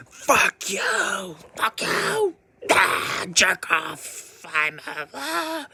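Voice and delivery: high, loud cartoonish rage outburst; over-the-top high-pitched cursing fit; mock angry son.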